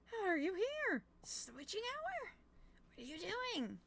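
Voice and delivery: high, incredulous questioning; high-pitched baffled asking; surprised questions. Real, not acted. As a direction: ask it as if you can't believe it.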